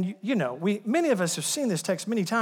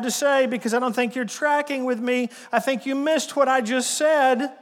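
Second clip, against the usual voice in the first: pleading Jesus; 7 semitones higher, 5 dB louder than usual. Pretend. Preacher voices Jesus pleading to listen.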